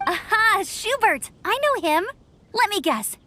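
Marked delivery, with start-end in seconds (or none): 0.1-2.0 higher, bright burst of recognition; eager recognition. Acted. Amber recognizing Schubert's name, excited and higher. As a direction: jump in, delighted to know him.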